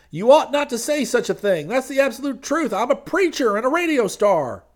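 loud, high glad-handing preacher voice; high preacher figure. Acted. Street preacher pitching to the crowd, loud and high. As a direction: boom it out like a street preacher selling salvation.

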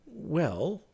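high, rising, hesitant admission; somewhat startled. A narrator's reading. Clifford is asked about his lovemaking, somewhat startled. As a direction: admit it, caught off guard. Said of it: "he admitted, somewhat startled".

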